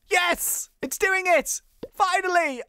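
high, bright cheering burst; excited cheer. Real, not acted. Gamer cheering the ritual at last, high cheer. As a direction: celebrate like you finally won. Summoning finally works.

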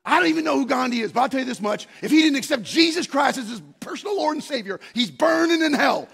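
loud, high, fast hellfire shout; a barber shouting his answer to a doubting student; fiery preacher. Retold or imitated. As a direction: thunder it like a street preacher. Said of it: "And he goes".

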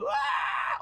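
mock kid scream. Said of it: "so he's, like, screaming".

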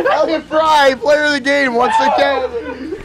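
loud laughing award call; excited announcer. Acted. Naming the player of the game, excited call. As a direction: shout it like a hyped broadcaster.

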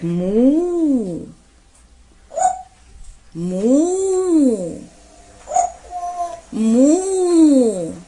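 imitated cow moo.

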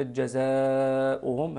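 جزاؤهم with the long vowel held, slow; long madd. Shown as a demonstration. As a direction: stretch the madd in جزاؤهم to six counts. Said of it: "مد بمقدار ست حركات".

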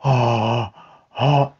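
very soft, low, breathless oh's; as if frozen at the sight of a dog; stunned hush. Retold or imitated. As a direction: silent reactive dog fixated on another dog. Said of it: "they just go".

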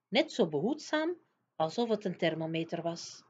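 ordinary delivery, nothing marked

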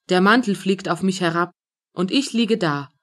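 ordinary delivery, nothing marked

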